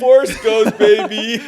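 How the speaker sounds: high, loud victorious cheer; triumphant shout. Real, not acted. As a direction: crow about being right. Triumphant after guessing right.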